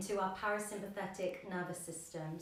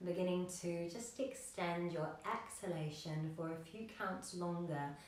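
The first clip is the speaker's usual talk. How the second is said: soft, slightly lowered yoga-teacher voice; guided meditation; 3 semitones lower, 6 dB quieter than usual. Acted. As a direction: guide them gently into the breath, with long pauses. Leads breathing meditation.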